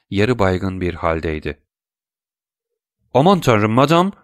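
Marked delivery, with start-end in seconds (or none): 3.1-4.1 higher, louder cry of alarm. A narrator's reading. Debray cries out in alarm to the fainting Madame Danglars. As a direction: call out to a fainting lady. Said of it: "diye haykırdı Debray".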